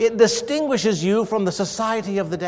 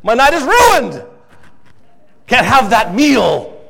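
louder, slow, put-upon whine; mock frustration; 4 semitones higher, 6 dB louder, slower than usual. Disappointed diner complaining, mock-dramatic frustration. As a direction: sulk over the ruined night, hammed up. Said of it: "we express some sort of disdain or frustration".

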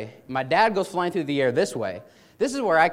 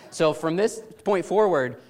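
usual speaking voice

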